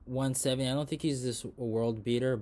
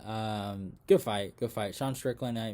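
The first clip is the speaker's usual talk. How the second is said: ordinary talk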